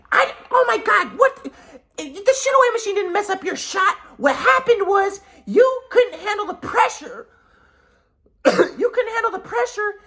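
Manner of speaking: very high, hammed-up scolding voice; berating mom impression. Mom blaming son for cracking under pressure, high berating imitation. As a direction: hammer the blame home in a piercingly high mock voice.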